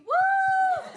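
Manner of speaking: high imitated whoop; crowd cheer. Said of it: "all clapping".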